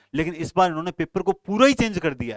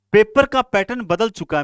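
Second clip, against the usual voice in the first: forceful, raised declaration; emphatic loud; 5 semitones higher, 8 dB louder than usual. Real, not acted. Teacher warning students, loud and emphatic. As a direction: hammer the warning home to students.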